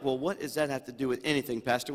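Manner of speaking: imagined congregation questioning the pastor, quick and hushed; mock doubting listener. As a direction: toss it off like a puzzled churchgoer. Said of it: "Now you're like".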